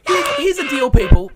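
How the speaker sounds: rally the listeners with a shout; loud call out. Real, not acted. Host grabs the audience's attention, shouting.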